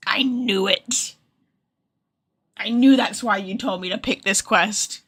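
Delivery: normal talking voice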